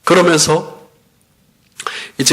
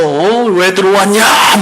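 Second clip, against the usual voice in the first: mock-hostile challenge, drawn out and a bit higher; retold cursing; 3 semitones higher, slower than usual. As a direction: imitate a possessed woman demanding an answer. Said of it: "막 욕을 해대는 거예요".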